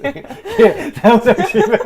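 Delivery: high, loud laughing speech. Real, not acted. Co-host cracks up at the power tools video, laughing hard. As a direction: crack up while getting the words out.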